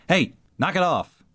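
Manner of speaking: high-pitched mock scolding call; mother's yell. Mother coming upon her squabbling children, mock yelling. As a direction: put on a mom breaking up a squabble, pitched well up. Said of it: "coming upon her children squabbling and yelling".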